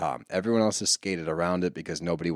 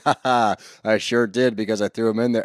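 mock snide husband; 6 dB louder than usual. Mocking Brock's snide reply.

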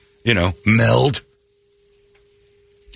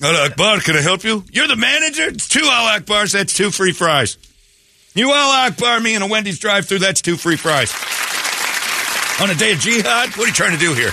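entitled customer; 8 semitones higher than usual. Acted. Manager then customer squabble.

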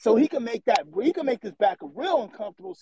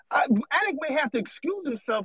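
ordinary talk, nothing marked

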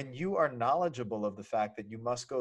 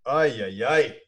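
choked up; 8 dB louder than usual. Real, not acted. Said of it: "And now I am getting choked up".

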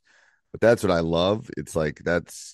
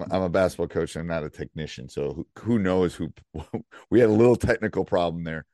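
normal talking voice

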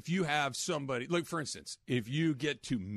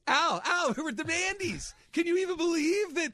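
much higher, louder excited party woman; Candace impression; 10 semitones higher, 4 dB louder than usual. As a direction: gush like a woo girl at a party. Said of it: "That's my Candace".

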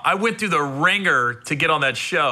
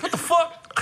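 high, laughing what the fuck; mock-stunned high exclamation; shocked disbelief; 12 semitones higher than usual. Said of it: "I was like".

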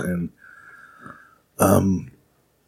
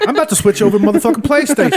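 much higher, quick, laughing through a Snoop rant; [name] impression; 13 semitones higher, faster than usual. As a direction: do Snoop griping about Xbox, laughing. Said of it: "his rant".

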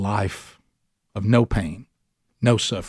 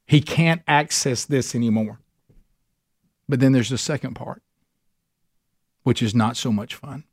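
ordinary talk

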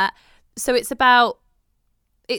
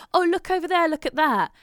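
mock excitement; 9 semitones higher, 4 dB louder than usual. Distracting the child.

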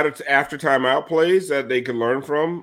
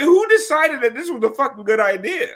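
high-pitched, exasperated retold reaction; incredulous; 10 semitones higher than usual. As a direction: ask it in mock disbelief, pitch jumping up. Retells own reaction to bad ticker.

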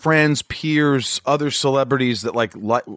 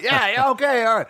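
high put-on agreeing voice; imitating JD's voice; 12 semitones higher than usual. Host imitating crew buddy agreeing to hang out, mimicking voice. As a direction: mimic a pal saying yes, pitched way up. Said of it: "That's how he talks".

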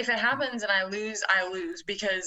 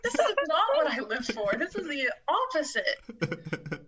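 higher, laughing disbelief; laughing protest; 6 semitones higher than usual. Retold or imitated. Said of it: "I was like".

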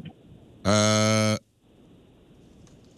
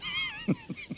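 a sudden squeaky laugh that breaks out; high burst of laughter; 11 dB quieter than usual. Real, not acted. Host cracking up before reading the next name, high laugh.

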